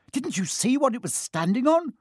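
high, exasperated question; snapping. A narrator's reading. Hermione quizzes the others about the dog, snapping. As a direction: scold them with rising exasperation. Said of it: "she snapped".

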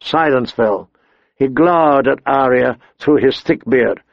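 plain reading voice

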